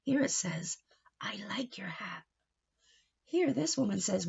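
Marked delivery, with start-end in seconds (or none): 1.2-2.2 whispered line. Acted. Speech bubble voiced.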